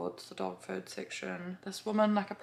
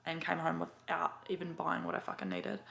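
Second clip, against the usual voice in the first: normal talking voice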